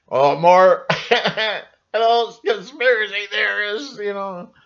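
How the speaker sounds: laughing mockery. Real, not acted.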